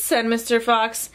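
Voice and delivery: usual speaking voice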